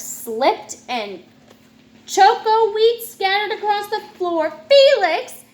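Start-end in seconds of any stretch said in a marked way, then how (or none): 4.7-5.4 loud, high Felix; yelled. Acted. Mom yelling at Felix over the spill, loud and high. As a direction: yell his name in exasperation. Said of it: "yelled his mom".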